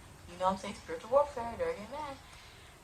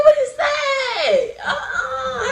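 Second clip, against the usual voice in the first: startled yelp; 9 dB louder, much slower than usual. Retold or imitated. Startled by a whoosh at night.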